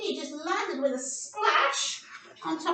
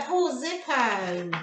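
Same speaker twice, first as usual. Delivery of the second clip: ordinary delivery, nothing marked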